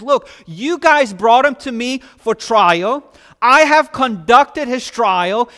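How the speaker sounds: loud, high, mocking Pilate; sarcastic taunt. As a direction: throw it back at them with scorn. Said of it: "in his sarcastic taunt".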